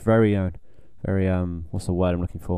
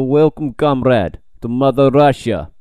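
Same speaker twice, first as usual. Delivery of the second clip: put-on louder, slow Russian accent; 5 dB louder, slower than usual. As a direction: announce it in a hammy Russian accent. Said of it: "In a Russian accent".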